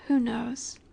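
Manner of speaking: normal talking voice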